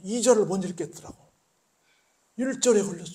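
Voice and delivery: loud, high impassioned preaching; preaching at full force. Acted. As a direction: cry it out like a fired-up preacher.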